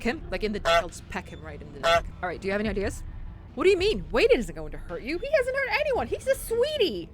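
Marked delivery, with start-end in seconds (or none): 3.5-7.1 high mock voice. Player voicing a naive defence of Wade, high and sing-song. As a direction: voice it sweetly and naively.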